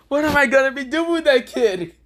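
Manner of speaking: high, stunned Naruto voice; shocked Naruto. Retold or imitated. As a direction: squeak it out in disbelief.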